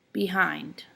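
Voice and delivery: "Behind" as be-HIND; light "Be", longer stressed "hind"; stress on second syllable. Shown as a demonstration. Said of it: "hind is stressed".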